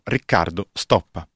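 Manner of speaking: usual speaking voice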